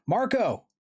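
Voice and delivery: high, loud, desperate call; yelled name. A narrator's reading. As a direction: shout out for a missing friend. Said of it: "I yelled".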